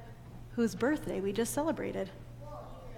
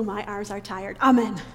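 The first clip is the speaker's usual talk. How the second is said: usual speaking voice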